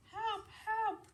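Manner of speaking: high tiny cry for help; a little one calling faintly; small brother's cry. Acted. Wayne cries from the hole.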